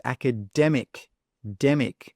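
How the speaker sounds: "academic" with DEM as the strong beat; stress on DEM. Shown as a demonstration. Teacher reminds learners of the word, stressing DEM. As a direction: hit DEM in "academic", then isolate demic. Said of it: "The stress is on that dem".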